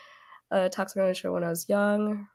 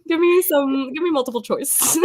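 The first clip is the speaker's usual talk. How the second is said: laughing plea; 7 semitones higher, 4 dB louder than usual. Pretend.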